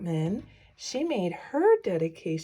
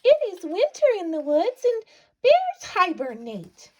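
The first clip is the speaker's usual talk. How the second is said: high, loud squeaky mouse voice; a little mouse scolding his friend, high and squeaky; 12 semitones higher, 5 dB louder than usual. Acted. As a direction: squeak it out like a tiny fussing mouse. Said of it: "Mouse squeaks".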